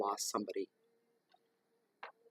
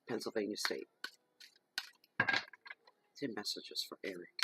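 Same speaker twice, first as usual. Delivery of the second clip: solemn invocation. Acted. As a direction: call them forth reverently. Invokes spirits for the reading.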